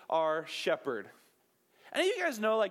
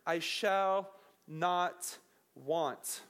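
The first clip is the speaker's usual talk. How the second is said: slow solemn reading; 2 semitones lower, much slower than usual. Shown as a demonstration. Pastor reciting the psalm, very slow and reverent. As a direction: let each word land.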